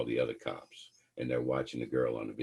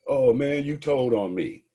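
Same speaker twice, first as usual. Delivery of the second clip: much higher, loud cry of betrayal; betrayed outcry; 9 semitones higher, 8 dB louder than usual. Acted. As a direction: wail at the friend who snitched. Buddy thinks friend snitched.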